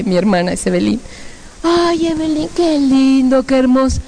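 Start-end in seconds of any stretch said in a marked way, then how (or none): 1.6-4.0 higher, cooing admiration; gushing relatives. Retold or imitated. Uncles fawning over the pretty sister, gushing. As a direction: gush over the favourite niece.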